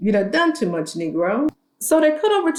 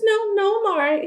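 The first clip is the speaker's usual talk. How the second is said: polite refusal; 11 semitones higher than usual. Retold or imitated. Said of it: "And she says".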